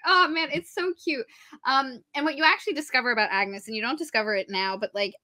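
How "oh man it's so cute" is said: gushing. Real, not acted. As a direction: coo over how cute she is. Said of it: "I was like".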